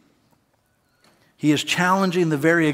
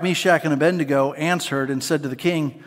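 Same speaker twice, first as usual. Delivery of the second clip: ordinary delivery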